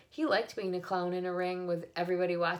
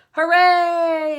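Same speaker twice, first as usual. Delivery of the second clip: loud cheering yell, much higher; crowd yell; 11 semitones higher, 16 dB louder than usual. A narrator's reading. The crowd yells for the pig, loud cheer. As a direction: roar it like a whole crowd. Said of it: "yelled the crowd".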